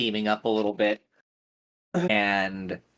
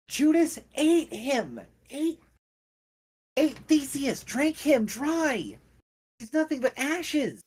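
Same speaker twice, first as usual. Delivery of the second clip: higher younger voice. Acted. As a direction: shriek it out in horror. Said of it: "a higher-pitched, younger voice".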